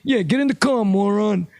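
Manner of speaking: a hint of a threatening tough guy; mock street confrontation. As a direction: voice Joe squaring up, rising on the car line. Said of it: "you wanna talk about Joe".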